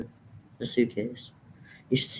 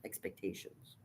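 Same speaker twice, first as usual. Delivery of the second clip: whisper; 4 semitones lower, 22 dB quieter than usual. Acted. Whispered punchline.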